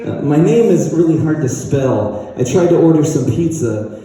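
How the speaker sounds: ordinary talk, nothing marked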